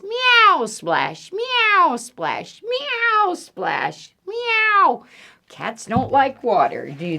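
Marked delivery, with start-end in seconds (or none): 0.0-5.0 high falling meows between plain splashes; cat meowing. Acted. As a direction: meow like an unhappy cat in water. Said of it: "and cat says".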